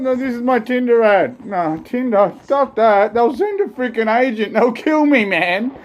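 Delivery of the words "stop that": ordinary talk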